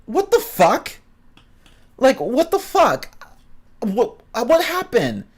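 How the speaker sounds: high, stunned cry of disbelief; stunned outrage. Retold or imitated. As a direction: squeal it out like you can't believe he won. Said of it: "And I'm like".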